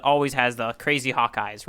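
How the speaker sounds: ordinary talk, nothing marked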